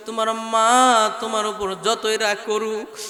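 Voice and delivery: ordinary talk, nothing marked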